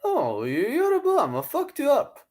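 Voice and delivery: very high strained put-on voice; Charlie Zelenoff impression. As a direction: ham up the deluded fighter. Imitating the fighter.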